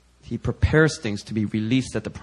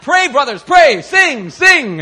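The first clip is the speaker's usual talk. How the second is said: high, loud urgent pleading; desperate plea; 13 dB louder, much slower than usual. Retold or imitated. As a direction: beg them loudly to pray and sing. Eunice pleading for prayer.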